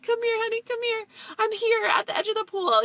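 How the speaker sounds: high, gentle coaxing call; soothing mom. Retold or imitated. Mom calling her hurt son to the pool edge, soothing. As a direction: coax a scared child toward you.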